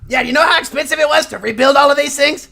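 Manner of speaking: put-on high, loud grumpy old gnome scolding; a crotchety gnome hollering at the adventurers for showing up late; cranky old gnome yelling. Mort complains about costs.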